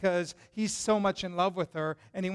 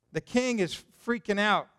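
ordinary talk, nothing marked

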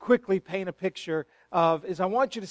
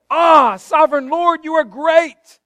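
high, loud shout of triumph; triumphant exclamation; 10 semitones higher, 15 dB louder than usual. Shown as a demonstration. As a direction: shout it out like a victory cry. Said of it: "in times of triumph".

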